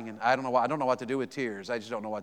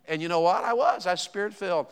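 usual speaking voice

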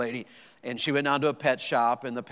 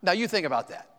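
ordinary delivery

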